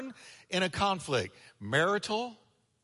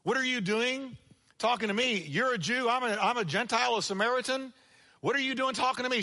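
woman at the well; 6 semitones higher than usual. Retold or imitated. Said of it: "She said".

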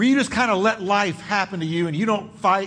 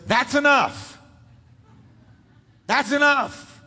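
higher, firm voice of God saying stop; a father's stern enough; firm rebuke; 7 semitones higher than usual. Acted. Preacher voicing God to the grumbling, firm rebuke. God's voice quoted in Bible.

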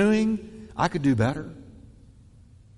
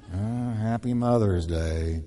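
grudging greeting; 8 semitones lower, 5 dB quieter, slower than usual. Shown as a demonstration. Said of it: "with the wrong tone".

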